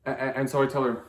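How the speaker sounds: ordinary talk, nothing marked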